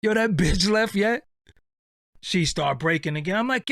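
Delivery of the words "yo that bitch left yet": high, loud street call through the window; cousin calling in. Retold or imitated. Cousin outside the window, high loud call. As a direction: holler it up from outside. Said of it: "So he like".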